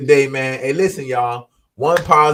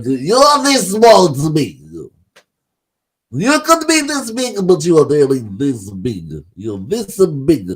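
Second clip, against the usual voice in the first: slow, high, louder mock Russian accent, hammed up; 3 semitones higher, 4 dB louder, slower than usual. Mocking Russia's view of a jailed American in a put-on Russian accent. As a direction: lay on a thick put-on Russian accent, slowly. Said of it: "Russians sound like that to me".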